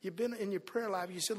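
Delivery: usual speaking voice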